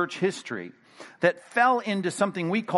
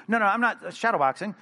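usual speaking voice

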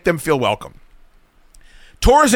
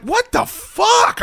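shocked outburst; much slower than usual. Retold or imitated. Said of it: "And I'm like".

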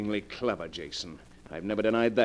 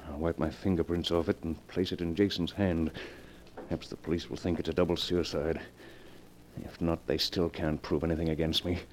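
cold murmur; 8 semitones lower, 6 dB quieter than usual. Acted. Gerald alone after the killings, muttering his plan quietly. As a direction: plot your escape under your breath.

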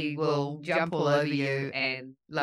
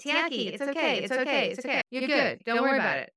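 soothing the dog; 6 semitones higher, faster than usual. Retold or imitated. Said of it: "I'm like".

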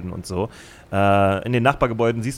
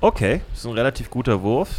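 normal talking voice

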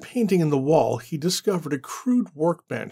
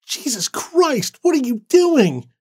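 high, shocked cry, slow then louder; shocked screech; 11 semitones higher, 5 dB louder than usual. Acted. Annie as Randall kicks the door in, high shocked cry. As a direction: shriek it in shock. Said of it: "Annie screeched in surprise".

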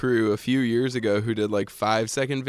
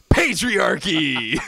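slow, stretched, louder proclamation; mock proclamation; 6 semitones higher, 4 dB louder, much slower than usual. The diarrhea man proclaiming to camera, slow. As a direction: drag it out like a punchline. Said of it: "looks at the camera and goes".

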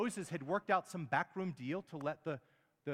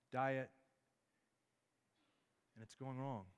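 soft, lowered preacher's voice with long pauses; quiet, searching questions, unhurried between phrases; hushed reflection; 3 semitones lower, 5 dB quieter than usual. Real, not acted. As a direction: gently leave room for them to think.

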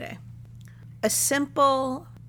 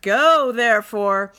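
loud, slow, over-grand scripture voice; grandly declaimed; 10 dB louder, slower than usual. Retold or imitated. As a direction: proclaim it with mock biblical grandeur. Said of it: "people don't really talk like that".

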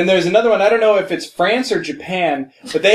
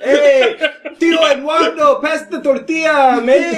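put-on Mexican accent; 7 semitones higher than usual. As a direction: call out for the tortilla, hammed up. Hammed-up Mexican uncle act.

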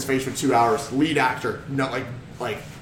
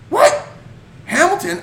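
surprised; slower than usual. Retold or imitated. Retold surprise at meeting neighbour.

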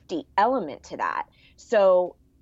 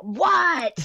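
a high, snapped what; a mom finally giving in after endless nagging; exasperated mom; 7 semitones higher, 3 dB louder than usual. Retold or imitated. Stewie's mom cracking at her son, exasperated what. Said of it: "finally she's like".

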